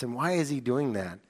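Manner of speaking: ordinary delivery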